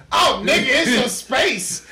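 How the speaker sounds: loud, high, drawn-out dismissal; mock someone brushing off a huge deal; hammed-up incredulous scoff; Neil Armstrong. Mimics astronaut dismissing space.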